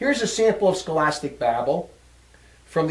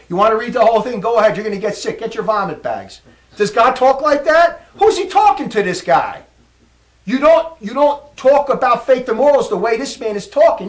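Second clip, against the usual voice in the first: louder, higher, scornful tirade; indignant outburst; 3 semitones higher, 7 dB louder than usual. Real, not acted. Preacher mocking the apostate's babble, loud and scornful. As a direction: rail at the text like it disgusts you. Preacher railing against scholastic text.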